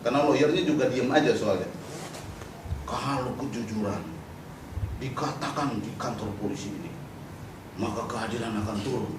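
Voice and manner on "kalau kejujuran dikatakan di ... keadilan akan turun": soft, low, earnest recital; naive pious activist. Retold or imitated. Religious activist trusting honesty at the police station, mock-earnest and hushed. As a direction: intone it as a naive believer. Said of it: "tapi ini naif, saya mau bilang naif".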